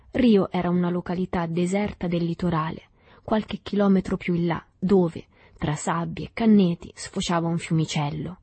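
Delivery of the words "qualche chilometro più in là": usual speaking voice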